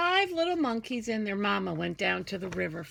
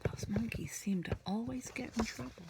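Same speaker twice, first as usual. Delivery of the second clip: soft secret aside; whispered aside; 7 dB quieter than usual. Acted. Teacher telling the class a secret about the book. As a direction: lean in and whisper it.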